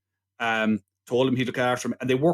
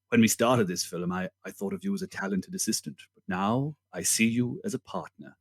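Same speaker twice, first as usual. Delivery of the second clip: softer, measured actor's delivery; lightly voiced thespian, quieter and deliberate; Olivier impression; 4 semitones lower, 5 dB quieter than usual. Olivier turns to Kane on set, lightly acted, measured and soft.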